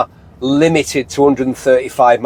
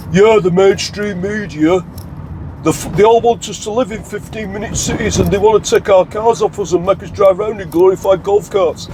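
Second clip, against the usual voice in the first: higher-pitched whingeing ranter impression; mocked conspiracy bloke; 5 semitones higher than usual. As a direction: imitate a bloke ranting about the mainstream media. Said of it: "those blokes that talk like that".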